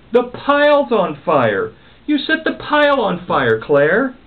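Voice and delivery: shocked, the pile is burning; alarmed. Acted.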